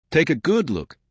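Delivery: ordinary delivery, nothing marked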